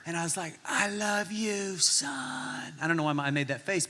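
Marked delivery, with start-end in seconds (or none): 0.6-2.7 voiced slow-motion reply, stretched out. Dad answering his son in the dream, slow motion. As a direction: draw every word out in mock slow motion. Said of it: "in slow motion you do".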